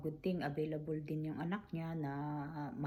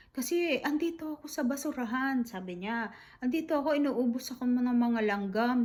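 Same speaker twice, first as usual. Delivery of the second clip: put-on distressed old mother; 7 semitones higher, 7 dB louder than usual. Mother complains of ants, distressed.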